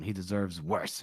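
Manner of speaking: low, quiet verdict; grim. Acted. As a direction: drop pitch and volume, cold and final.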